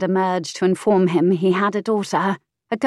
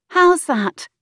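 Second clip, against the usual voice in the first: put-on higher, slower, pert young woman; saucy mistress voice, high; 10 semitones higher, 4 dB louder than usual. Mistress snapping at the earl, pert high voice. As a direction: tease him back, pitched up and slow.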